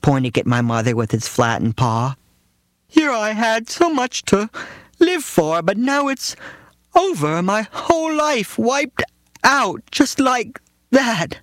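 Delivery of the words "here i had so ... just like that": very high, slow tragic cat lament; dying cat mourning its lost life; melodramatic dying cat. Retold or imitated. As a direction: wail it like a hammy dying cat. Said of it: "the cat said".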